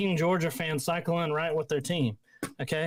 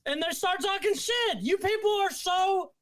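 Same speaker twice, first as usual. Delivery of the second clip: pitched way up, ranting without letup; heated rant; 8 semitones higher than usual. Real, not acted. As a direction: vent your outrage, voice climbing higher. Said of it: "Are you mad?".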